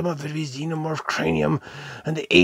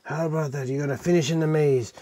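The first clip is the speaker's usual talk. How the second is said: ordinary delivery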